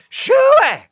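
second high, loud shoo with a falling end; repeat holler. Acted. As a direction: yell it again across the yard. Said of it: "I hollered at them".

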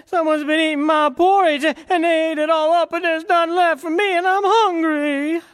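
put-on very high, loud little baby bear; a tiny bear whining that it's all gone; squeaky-high wee bear complaining. A narrator's reading. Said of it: "and said".

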